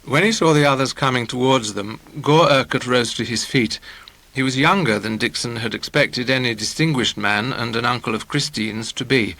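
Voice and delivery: ordinary delivery, nothing marked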